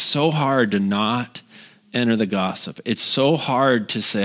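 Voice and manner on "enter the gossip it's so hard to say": ordinary talk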